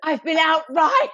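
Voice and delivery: high, hammed-up hero; mock Poldark. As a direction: overplay the dashing rider. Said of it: "he's like".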